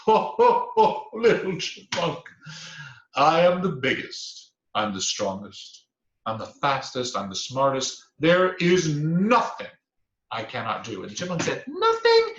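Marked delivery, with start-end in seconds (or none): 0.0-11.0 loud "Ho, ho" then booming boasts; laughing bragging bear. A narrator's reading. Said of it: "and laughed". 11.7-12.3 very high, loud "Nothing"; chipmunk's sly echo. Acted.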